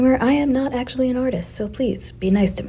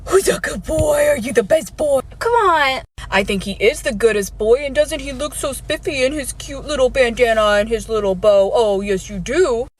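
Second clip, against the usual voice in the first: put-on baby talk to dog. Cooing to the puppy.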